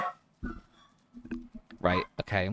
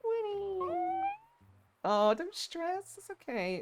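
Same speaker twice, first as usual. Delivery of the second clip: very high, slow cooing pet voice; 5 dB quieter, slower than usual. Real, not acted. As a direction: coo at a frightened puppy, stretching the words. Baby talk to stressed dog.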